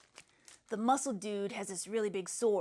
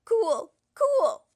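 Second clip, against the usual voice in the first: high, loud and thrilled; a kid cheers the giant's fall; excited; 10 semitones higher, 9 dB louder than usual. Acted. Excited reaction.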